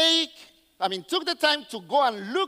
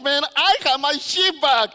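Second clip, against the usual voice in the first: loud, overjoyed bragging; happy shepherd; 2 semitones higher, 5 dB louder than usual. Acted. Shepherd walking around with his found sheep, happy and loud. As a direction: crow with joy over the find. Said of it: "he probably was happy just walking around all happy".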